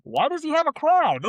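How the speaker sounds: high, loud background voice calling out a question; puzzled onlooker piping up from the crowd; background heckler. Acted. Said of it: "in the background".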